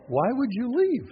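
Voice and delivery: ordinary delivery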